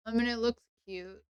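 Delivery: normal talking voice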